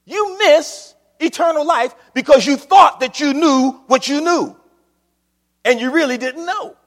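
loud, driving sermon warning; preaching at full force. Real, not acted. Preacher warning the congregation about missing heaven, full force. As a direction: hammer the warning home to the flock.

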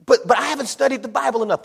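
high, quick mock protest; protesting excuse. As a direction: stammer out a hurried excuse. Voices believer making excuses.

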